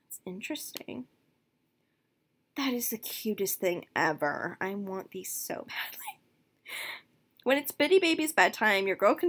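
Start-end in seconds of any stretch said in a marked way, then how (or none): 0.1-1.1 quick whispered aside; whispering. Real, not acted. 4.6-6.2 soft whispered longing; whispering. Real, not acted.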